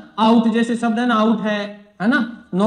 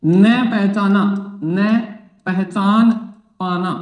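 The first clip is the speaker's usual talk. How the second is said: slow dictation; 2 semitones lower, much slower than usual. Shown as a demonstration. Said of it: "teacher dictating slowly".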